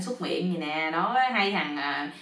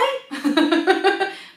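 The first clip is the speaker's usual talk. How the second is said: high, loud laughing burst; storyteller's laugh; 11 semitones higher, 4 dB louder than usual. Real, not acted. Storyteller amused by his own joke, loud laugh.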